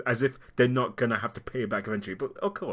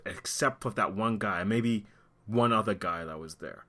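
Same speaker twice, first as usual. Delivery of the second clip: usual speaking voice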